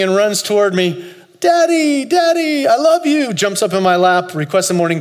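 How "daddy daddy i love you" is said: put-on much higher and louder, joyful little-boy cry; excited toddler. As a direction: call out like a thrilled small child. Dreamed toddler runs to dad.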